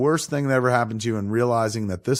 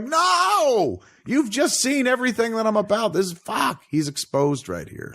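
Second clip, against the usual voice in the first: high, frantic whiny protest; hammed-up high panic; mock panicked Bruce Wayne; 12 semitones higher than usual. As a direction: squeal it like a caught-out hero. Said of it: "You're like".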